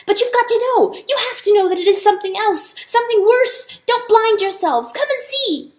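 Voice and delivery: high, loud frantic mouse cry; squeaky mouse cry to the animals. A narrator's reading. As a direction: shout it out, desperate to be heard. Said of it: "he cried".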